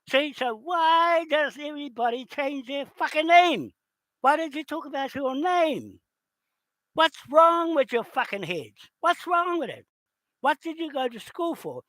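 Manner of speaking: high, incredulous angry rant; exasperated outburst. Real, not acted. Ranting at people changing names.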